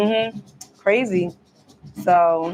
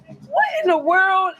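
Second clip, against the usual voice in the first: baffled; 9 semitones higher than usual. Retold or imitated. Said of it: "And I was like".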